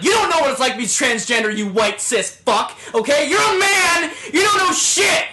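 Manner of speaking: high, loud, hammed-up shouting; mock furious rant. The writer rants at a critical classmate with a high mock yell. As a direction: rant back at him, building to a yell.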